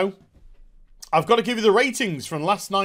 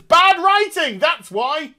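much higher, louder mock voice; high mock quip; 9 semitones higher, 5 dB louder, slower than usual. Brandon Routh mocking the writers in a high, louder voice. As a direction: chirp it out high and pleased. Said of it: "Brandon Routh says".

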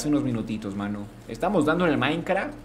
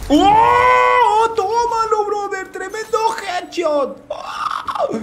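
grito agudo y eufórico por el headshot; grito de celebración; 6 dB louder, much slower than usual. Real, not acted. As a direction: celebra a todo pulmón, muy arriba. (gritando de euforia a su chat tras el headshot)

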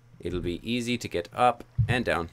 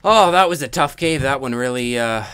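exasperated exclaim; 6 semitones higher, 11 dB louder than usual. Real, not acted. Gamer venting after surviving a tough cave, loud exclaim. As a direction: blow off steam after a hard fight.

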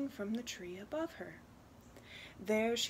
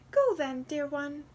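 higher, kindly wren voice; little talking bird; 5 semitones higher, 6 dB louder than usual. Acted. Wren sends the girl home, higher bird voice. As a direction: send the child off as a little magic bird. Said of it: "the wren said".

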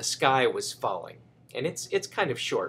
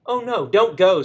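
louder, higher warning cry; alarmed cry; 5 semitones higher, 7 dB louder than usual. Acted. Cocky Locky warning Goosey Poosey, mock alarmed cry. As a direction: call out to stop her. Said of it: "said Cocky Locky".

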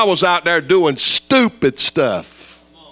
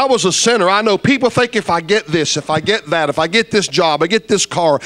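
ordinary talk, nothing marked

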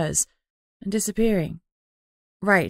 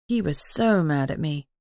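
ordinary delivery

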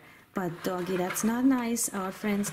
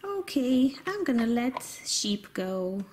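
usual speaking voice

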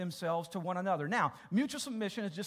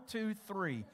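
ordinary talk, nothing marked

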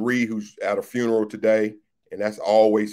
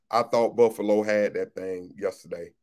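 normal talking voice

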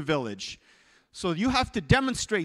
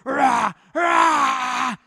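repeated roars, rising louder and higher; charging lion; 7 semitones higher, 12 dB louder, much slower than usual. Acted. Preacher voices the lion bearing down, roaring. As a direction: roar in bounding bursts. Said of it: "that lion's coming".

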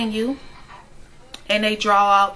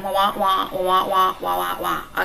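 wordless wah-wah drone mocking the doctor; mock droning talk; 3 semitones lower than usual. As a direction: drone it out like noise you tune out. Doctor's talk as meaningless noise.

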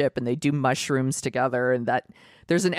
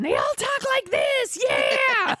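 cartoon cat voice; slower than usual. Shown as a demonstration. Showing how the comedian's cats talk, high silly cat voice. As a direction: squeal it like an excitable dubbed cat. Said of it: "he, like, overdubs these voices".

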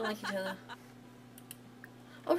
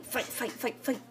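playful fight chant; egging on a fight; 4 semitones higher, slower than usual. Pretend. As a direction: chant it like a crowd goading them. Egging on the quarrelling characters.

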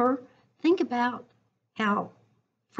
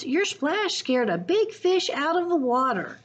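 excited cubs; 9 semitones higher than usual. Acted. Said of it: "said the cubs".

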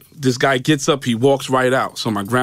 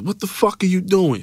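angry cursing; 9 semitones higher than usual. Retold or imitated. Detective stopping his colleague's lunge, mock angry cursing. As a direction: imitate an outraged cop, pitched up. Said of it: "Starts cursing".

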